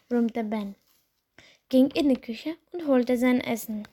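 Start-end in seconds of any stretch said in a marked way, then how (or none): none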